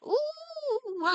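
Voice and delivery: high, drawn-out witchy "ooh"; witch's ooh. Acted. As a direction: let out a long, eerie high "ooh". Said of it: "elven woman wearing a, like, a witch's hat".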